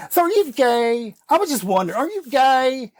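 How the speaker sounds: high-pitched nosy blurting, imitated; imitating loud woman. Woman asking him in a meeting, imitated loud. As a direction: mimic her blunt, too-public question. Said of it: "she was very loud".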